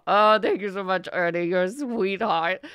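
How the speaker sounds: bustling, cheery fat merchant; jovial merchant. Acted. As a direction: gush thanks like a jolly shopkeeper. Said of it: "he comes in bustling".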